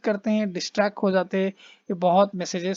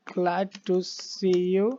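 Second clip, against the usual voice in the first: very slow, word by word; slow dictation read; much slower than usual. Shown as a demonstration. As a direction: spell it out slowly for students. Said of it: "होगा".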